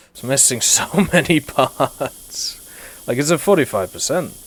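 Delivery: slow amused laugh over a wreck. Real, not acted. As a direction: chuckle out the words slowly. Amused at the rusty tank.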